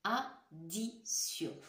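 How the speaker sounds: Addition drawn out very slowly; slow careful word. Shown as a demonstration. Teacher slowly repeats addition for listening. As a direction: stretch out Addition syllable by syllable. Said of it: "écoutez bien le mot".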